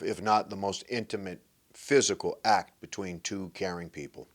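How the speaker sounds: normal talking voice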